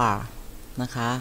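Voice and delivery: normal talking voice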